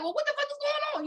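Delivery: high, fast alarmed voice; quick high frightened question; retold panic. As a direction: blurt it high and scared. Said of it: "She like".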